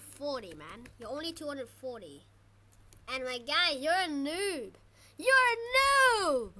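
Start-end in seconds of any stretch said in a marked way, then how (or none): 5.2-6.5 high, loud, drawn-out jeer; taunting yell. Real, not acted. Player jeering at a weaker rival, taunting yell. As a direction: shout it slowly to rub it in.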